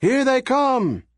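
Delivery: high excited yell. A narrator's reading. Crowd members warn each other police approach, high yell. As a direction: shout the warning from the crowd. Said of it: "others were yelling".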